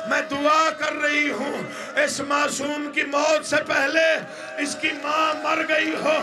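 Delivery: high, crying lament with shouted lines; mourning preacher crying out the tragedy in full force; impassioned masaib recitation. Acted. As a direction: wail the mother's plea to the gathering.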